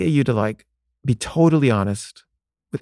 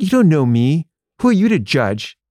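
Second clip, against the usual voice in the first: raised, louder indignant comeback; defensive retort; 5 semitones higher, 4 dB louder than usual. Retold or imitated. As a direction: push back hard at the accuser. Heated retort to his accuser.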